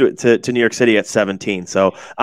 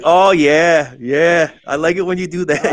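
higher-pitched mock Boston girlfriend; attempted Boston accent; 6 semitones higher than usual. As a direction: play a girl with a Boston accent, roughly. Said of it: "whatever that Boston accent is".

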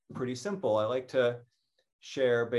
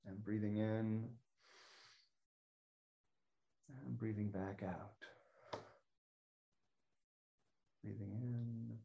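guided breathing; 14 dB quieter than usual. Shown as a demonstration. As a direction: lead the breath gently, leaving long pauses. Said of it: "Welcome to do the alligator breath along with me".

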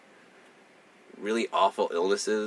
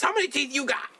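imitating loud patient. Retelling a patient across the hall, high mock woman. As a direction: ham up a brassy woman. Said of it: "harassing the dentist".

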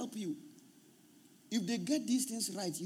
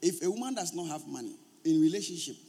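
ordinary talk, nothing marked

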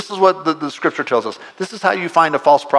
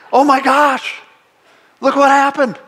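excited; 8 semitones higher, 4 dB louder than usual. Acted. Imagining joyous revival in Boulder.

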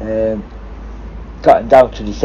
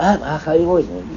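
guilt-tripping greeting; 4 semitones higher, 6 dB quieter than usual. Retold or imitated. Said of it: "he was trying to guilt me".

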